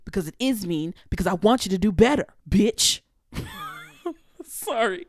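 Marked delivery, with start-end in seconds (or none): 3.3-5.1 sheepish laugh into a soft higher sorry; laughing apology. Real, not acted. Laughing sorry to viewers after calling them bitch. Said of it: "I'm just so angry".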